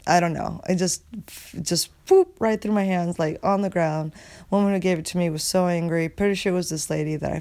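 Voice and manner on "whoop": quick high whoop; a sudden high-pitched slip noise; imitated whoop. Dreamer showing the baby slipping through hands, high whoop.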